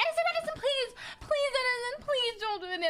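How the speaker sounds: high-pitched, near-crying begging; mock panic pleading. Begging Edison not to puke on the floor, panicked. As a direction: plead as panic takes over. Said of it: "until my voice, like, starts hitting panic levels".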